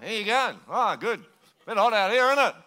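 mumbled rural Aussie through nearly shut lips; lazy outback Australian talk; closed-mouth outback Australian. Shown as a demonstration. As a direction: speak Australian outback without opening your mouth. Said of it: "the less people open their mouth".

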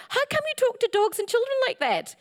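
ordinary delivery

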